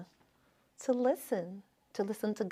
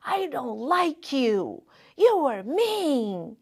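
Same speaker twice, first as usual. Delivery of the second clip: put-on mildly childish sulky voice, slow and a bit higher; angry little child; 5 semitones higher, 7 dB louder, slower than usual. Girl tells her mom she dislikes her, sulky child voice. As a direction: sulk it out like a seven-year-old. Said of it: "And when you're little".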